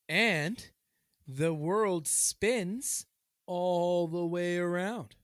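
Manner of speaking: very slow, drawn-out announcement; slow revelation. Real, not acted. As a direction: stretch every word for the reveal. Deliberate mock-news revelation.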